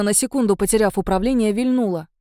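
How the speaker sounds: plain reading voice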